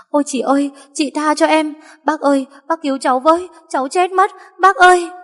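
high, wailing mock victim; fake pitiful pleading. A narrator's reading. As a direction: play the helpless victim, rising to a loud cry. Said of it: "tỏ ra bộ dạng hiền dịu nết na, như mình là một kẻ bị tấn công". (giọng van xin giả vờ đáng thương)

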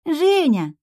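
put-on high, loud little-girl call; joyful child. A narrator's reading. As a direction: greet a favourite grown-up with delight. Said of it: "Соня тут же с радостью подбежала".

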